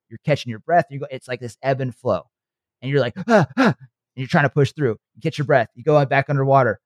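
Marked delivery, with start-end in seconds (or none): 3.3-3.8 high mock gasps for air; panicked gasps. Imitating panic while coming up from underwater.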